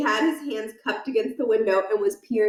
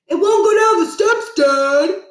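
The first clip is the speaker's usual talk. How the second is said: high, loud complaint; whining call; 10 semitones higher, 10 dB louder, slower than usual. Acted. Son complaining to his dad about the cow, loud.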